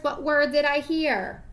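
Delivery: ordinary talk, nothing marked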